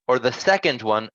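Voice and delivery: ordinary talk, nothing marked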